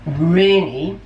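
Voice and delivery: usual speaking voice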